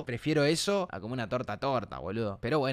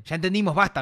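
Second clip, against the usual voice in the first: mock annoyed reading, louder and higher; leyendo el chat en voz alta; 5 semitones higher, 7 dB louder than usual. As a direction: read the viewer's complaint back louder, half-joking. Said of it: "para que se tilteen". (leyendo burlón y más fuerte el mensaje del chat)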